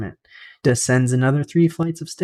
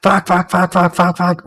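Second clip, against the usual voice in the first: higher, louder panicked yell of fuck; 5 semitones higher, 3 dB louder than usual. Acted. Prisoner yells as the face jerks toward him, panicked yell. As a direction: shout it as the face lunges at you.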